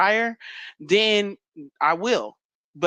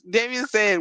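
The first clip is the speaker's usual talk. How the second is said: normal talking voice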